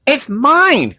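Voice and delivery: high, sharp snap; snapped. Acted. Hickory snapping at Pecan over the nut, high. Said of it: "snapped Hickory".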